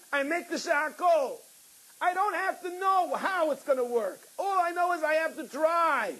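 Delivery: preaching high and intense; fervent preacher voice; impassioned preaching. Acted. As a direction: lift the sermon to a fervent pitch. Preaching at full force, crying heard.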